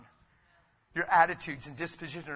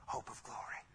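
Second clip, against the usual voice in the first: breathy whisper on "hope of glory"; 11 dB quieter than usual. Acted. Pastor whispering the key line to the congregation. Closing a sermon point.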